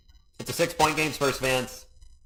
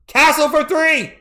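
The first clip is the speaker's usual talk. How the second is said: excited shout; 9 dB louder, slower than usual. Real, not acted. Live call of a three pointer.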